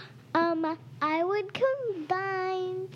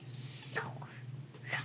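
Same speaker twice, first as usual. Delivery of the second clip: teasing whisper; 12 dB quieter, slower than usual. Real, not acted. Sister whispers teasingly at her brother, quiet taunt. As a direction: hush it out, mocking and breathy.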